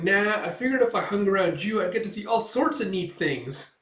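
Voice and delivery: high, eager character voice; breezy little sidekick. Retold or imitated. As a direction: explain it with perky enthusiasm.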